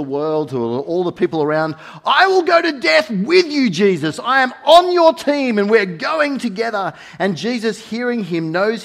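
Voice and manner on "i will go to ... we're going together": a high, loud vow of loyalty; bold declaration. Retold or imitated. Peter vows to Jesus before everyone, loud and high. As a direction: proclaim it to the whole crowd.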